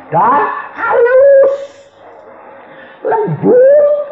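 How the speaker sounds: very high, drawn-out falsetto swell; loud, comically dainty emphasis; exaggerated delicacy. Shown as a demonstration. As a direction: stretch each word into a high coo. Describing wife's gentleness.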